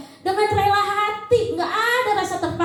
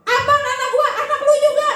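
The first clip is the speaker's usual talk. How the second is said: high, louder laughing mock retort; bickering parent; 10 semitones higher, 4 dB louder than usual. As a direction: snap back at your spouse. Parents arguing over baby.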